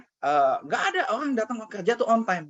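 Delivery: high, heated telling-off; angry scolding. Retold or imitated. Uncle berating nephew for arriving on time, angry. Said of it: "dia sebegitunya marah".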